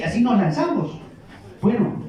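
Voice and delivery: normal talking voice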